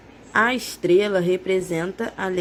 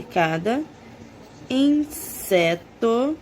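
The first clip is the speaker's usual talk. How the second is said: each word said slowly, with long pauses between; slow dictation for young writers; dictation pace; slower than usual. Shown as a demonstration. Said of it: "Agora vamos copiar".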